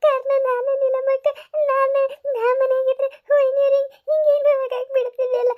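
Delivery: high, tearful self-blame, close to sobbing; tearful lament. Acted. Lakshmi blaming herself to Neelammakka, tearful high lament. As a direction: wail it out as if it is all your fault.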